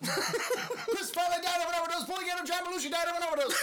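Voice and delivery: race caller. Pretend. Mock horse race call.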